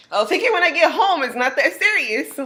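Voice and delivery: high, breezy brush-off; dismissive brush-off. Retold or imitated. Brushing off her pushy friend, breezy and high. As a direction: laugh it off, no big deal.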